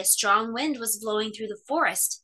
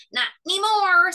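snuffling hedgehog; 9 semitones higher, 7 dB louder than usual. A narrator's reading. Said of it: "snuffled the hedgehog".

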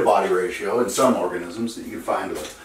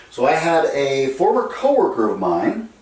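normal talking voice

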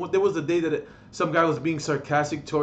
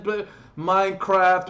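normal talking voice

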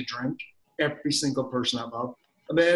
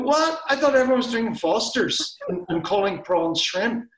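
baffled customer; 7 semitones higher than usual. Retold or imitated. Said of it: "You go".

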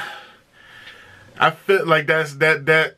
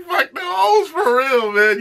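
mock tough guy; 12 semitones higher than usual. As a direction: ham up the fake tough talk.

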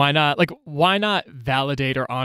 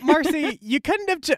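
self-scolding; 12 semitones higher than usual. Retold or imitated. Scolding her younger self.

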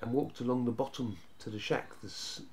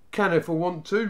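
raised, defiant bluff; louder, higher bravado; pretending not to mind; 5 semitones higher, 6 dB louder than usual. A narrator's reading. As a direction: answer back with put-on unconcern. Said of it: "pretending not to mind".

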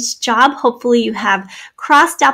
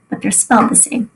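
ordinary talk, nothing marked

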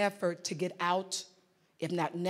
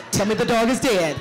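louder, blunt: "Tell me the dog is dead."; blunt and direct; 2 semitones higher, 10 dB louder than usual. Shown as a demonstration. Coach showing women to skip the Oprah version, blunt. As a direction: cut straight to the point. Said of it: "Just get to it".

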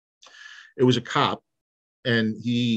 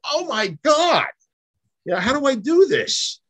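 dismayed cry; 4 dB louder than usual. Retold or imitated. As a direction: voice climbing high in helpless dismay. Retold reaction on the phone.

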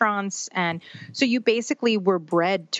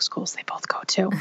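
whispered aside; 4 dB quieter than usual. Real, not acted. Joking aside about tuition.

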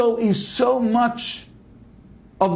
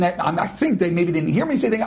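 ordinary delivery

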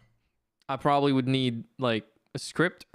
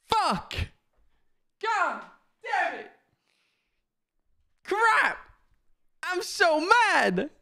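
squeaky frustration; much slower than usual. Real, not acted. Streamer mad at self after dodging a game, squeaky frustration. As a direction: curse in a high, frustrated squeal. Cursing over dodged game.

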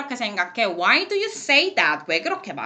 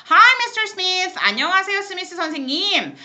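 high, bright greeting to a guest; cheery radio host; 9 semitones higher, 5 dB louder than usual. Acted. Host greeting the English teacher on the show, bright and high. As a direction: welcome the guest on air.

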